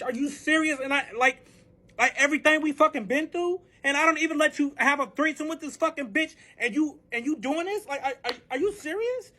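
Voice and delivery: high-pitched indignant rant; worked-up retelling of a furious tirade; incredulous outrage. Retold or imitated. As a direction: rant in disbelief, pitch climbing. Retold inner rant at cheating partner.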